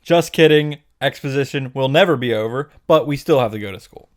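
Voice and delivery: ordinary talk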